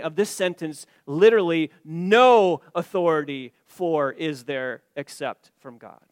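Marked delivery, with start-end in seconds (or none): 1.8-6.1 "No" drawn out long and loud at the very start; stretched, forceful "No" leading a slow literal reading; emphatic NO. Shown as a demonstration. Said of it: "It's emphatic".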